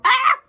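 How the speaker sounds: sudden piercing high yelp. Real, not acted. Vlogger, wired on coffee, yelps loud and high. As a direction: let out a sharp high scream. Same clip replayed.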